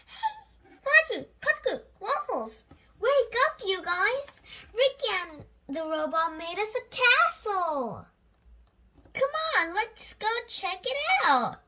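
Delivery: Lucy calls her pets to come see the castle; excited calling out. Acted. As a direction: wake everyone up with delight. Girl wakes pets excitedly.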